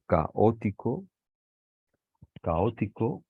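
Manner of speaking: "caótico" slow, ca and ó in separate syllables; split hiatus. Shown as a demonstration. Teacher showing the class a hiatus, split vowels. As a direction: draw out "caótico", parting a from stressed ó. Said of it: "las tenemos que separar".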